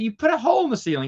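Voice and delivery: high rapid scolding; outraged roommate. Retold or imitated. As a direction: rattle off the complaint. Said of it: "He said".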